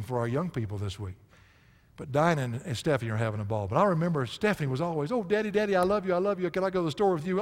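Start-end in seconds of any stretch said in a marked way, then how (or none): 5.1-7.4 higher, lightly voiced little daughter begging; a hint of an adoring child; sweet little girl. Father imitating his little daughter, sweet and higher. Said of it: "she was so precious, so sweet".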